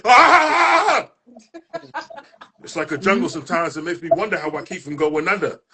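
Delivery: building, rhythmic spoken-word delivery; spoken word performance. Acted. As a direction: perform it like a poem slam, rising toward the shouts.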